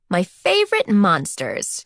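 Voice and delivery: slow, drawn-out affectionate cry; gushing cry. A narrator's reading. Kate greets the little boys hugging her legs, gushing cry. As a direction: cry out a fond greeting, stretching the words. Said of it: "she cried".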